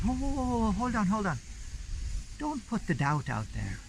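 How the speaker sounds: put-on high Irish accent, soothing hold on; Irish lady. Lady calming panicking Mark about his wife, Irish accent. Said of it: "it always is in an Irish accent".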